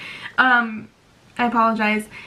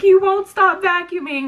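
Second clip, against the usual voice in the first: mock upset whine; 8 semitones higher, 4 dB louder than usual. Retells upset outburst at husband.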